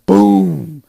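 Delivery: sudden loud, drawn-out boom; booming imitation blast. Reviewer retells a sudden explosion, booming loudly.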